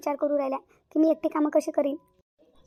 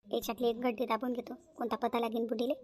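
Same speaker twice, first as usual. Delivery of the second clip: hushed scheming aside under the breath; sly aside; 4 semitones lower, 11 dB quieter than usual. Acted. Niece hiding cash from her aunt, a hushed sly aside. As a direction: mutter it so the old aunt cannot hear.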